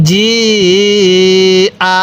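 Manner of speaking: long steady "জি", then short "আ"; four-alif stretch. Shown as a demonstration. Teacher showing learners the hamza rule, long "জি", short "আ". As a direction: stretch "জি" out and land briefly on "আ". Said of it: "তখন কিভাবে পড়ব?".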